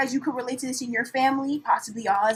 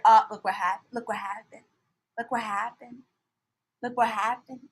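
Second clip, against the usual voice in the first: ordinary delivery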